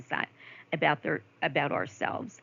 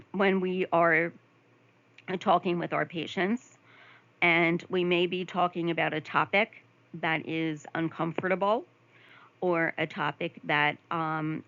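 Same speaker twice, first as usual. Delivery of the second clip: normal talking voice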